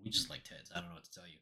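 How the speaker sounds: very soft, whispered confession; barely audible joking aside; hushed aside. Pretend. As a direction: mutter it low under your breath. Muttered aside under the other speaker.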